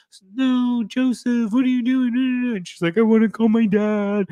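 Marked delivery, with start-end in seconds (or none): 0.1-2.6 high, loud imitation of tearful wailing; hammed-up crying, stretched into a high wail; mock crying. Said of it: "like, crying, he's like". 3.0-4.3 loud, high-pitched fake wail; exaggerated crybaby wailing; mock crying. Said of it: "like, crying, he's like".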